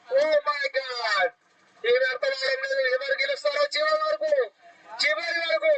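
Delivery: high, shouting six call; excited cricket commentary. Real, not acted. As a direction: scream with joy at the six. Six hit, commentator erupts.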